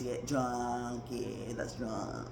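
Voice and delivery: low soft deadpan trail-off; low mumble. Real, not acted. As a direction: mutter it off to yourself.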